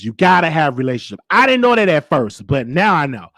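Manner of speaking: ordinary talk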